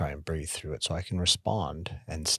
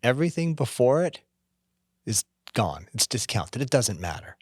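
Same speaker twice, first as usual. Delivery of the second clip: ordinary talk, nothing marked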